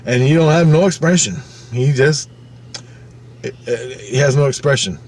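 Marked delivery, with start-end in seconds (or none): none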